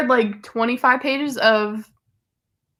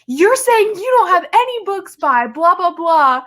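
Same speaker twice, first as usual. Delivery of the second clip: high-pitched mock outrage, a mild yell; retold yelling; 7 semitones higher than usual. Friend retelling a bookstore scene, mild mock yelling at cashier. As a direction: imitate an indignant customer, pitched up. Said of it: "she was yelling at the cashier".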